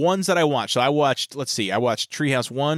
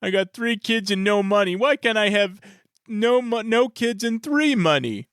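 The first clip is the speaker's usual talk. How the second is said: higher, slow dopey whine; Homer voice; 9 semitones higher, slower than usual. Retold or imitated. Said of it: "it'd be like".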